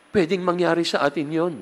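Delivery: ordinary delivery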